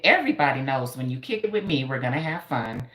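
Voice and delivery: usual speaking voice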